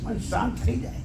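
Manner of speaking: softly repeating મન શાંત થઈ જાય so the calm is heard; hushed and calm. Shown as a demonstration. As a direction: let મન શાંત થઈ જાય settle into a soft hush. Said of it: "લાગે ના તો કહો".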